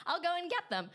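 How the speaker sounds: high, chirpy offer; eager helpfulness. Retold or imitated. Receptionist off to fetch guest's sneakers, high eager voice. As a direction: offer keenly with the pitch lifted high.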